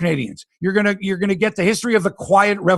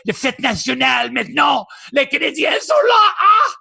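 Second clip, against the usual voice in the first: Quebec fan celebrating; 7 semitones higher than usual. Retold or imitated. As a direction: cheer like a Habs fan on the holiday. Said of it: "if you're a Montreal fan and you're in the province of Quebec".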